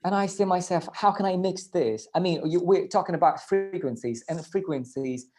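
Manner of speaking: usual speaking voice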